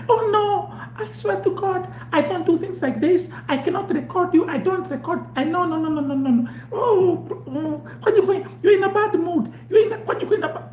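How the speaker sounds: high, laughing, flustered denial; flustered woman. Retold or imitated. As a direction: squeal the denials nervously. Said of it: "I could tell you're recording".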